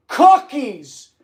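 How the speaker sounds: high, loud shouted cry; scream. Shown as a demonstration. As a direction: yell it out like a lookout. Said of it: "you have to scream".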